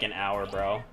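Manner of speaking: ordinary talk, nothing marked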